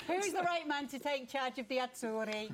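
ordinary delivery

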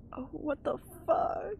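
slow drawn-out shocked oh; taken aback; disbelieving. Real, not acted. Shocked at gruesome find.

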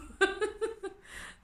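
high burst of laughter. Real, not acted. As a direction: laugh at your own silliness. Laughs after silly greeting.

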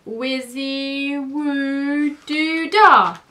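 very slow, high incantation swelling loud; cried spell. A narrator's reading. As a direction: draw out the magic words to a big finish. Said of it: "cried Keith".

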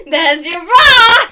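loud, drawn-out laughing holler; laughing shout. Real, not acted. Prankster showing someone their bra, laughing shout. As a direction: belt it out through laughter, stretching the last word. Playful outburst while grooming.

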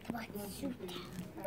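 slow, low, voiceless whisper. Acted. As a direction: breathe the threat. Creature whispers threat.

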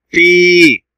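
في held long and loud on one level note; long ee vowel. Shown as a demonstration. Teacher repeating the long yaa sound, long held ee. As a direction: sustain the ee of في without gliding. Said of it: "صوت طويل ممدود بالياء".

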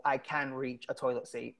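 ordinary talk, nothing marked

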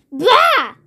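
high, scornful burst; snorting. A narrator's reading. Mister Grumble not amused by the clown, snorting. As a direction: snort it in disgust. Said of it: "he snorted".

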